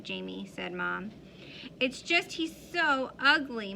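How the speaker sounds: ordinary delivery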